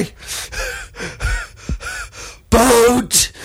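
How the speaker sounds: half-laughing shout of boat; calling to a boat. Retold or imitated. Drowning snorkeler hails the distant boat, laughing shout. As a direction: yell for the boat between laughs.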